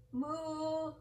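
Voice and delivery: put-on a high playful moo for the kids; cow moo. Shown as a demonstration. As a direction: moo like a cow, showing the children how.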